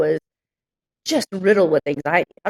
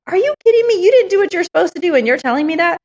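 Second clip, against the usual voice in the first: high-pitched, indignant disbelief; retold exasperated comeback; incredulous retort; 10 semitones higher than usual. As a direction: fire back in acted outrage, pitch way up. Acted retort to bookkeeper.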